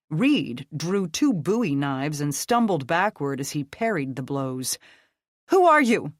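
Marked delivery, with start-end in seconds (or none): 5.5-6.1 loud, high snapping demand; sharp angry bark; snapped. Acted. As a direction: snap it at him mid fight. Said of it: "Becca snapped".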